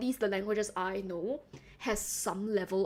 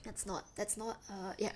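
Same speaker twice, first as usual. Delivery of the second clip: normal talking voice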